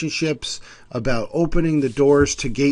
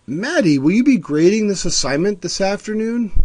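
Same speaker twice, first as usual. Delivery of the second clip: higher, eager student voice; student asking teacher Maddie, eager and higher; 7 semitones higher than usual. Retold or imitated.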